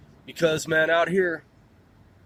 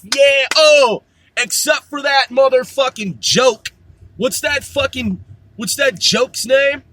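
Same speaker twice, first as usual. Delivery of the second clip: loud scorn; 5 semitones higher, 5 dB louder than usual. Real, not acted. Mock outrage at president.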